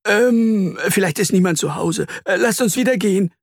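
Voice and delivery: high, hesitant, jittery voice; hedge, then hurry to get away; nervous backing out. Acted. Earthworm wants to leave the web, nervous.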